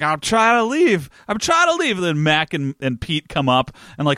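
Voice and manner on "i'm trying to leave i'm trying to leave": put-on pleading drunk Santa. Drunk Santa begging Carol to stop, high whine. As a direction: whine as if cornered and being beaten. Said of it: "And he's like".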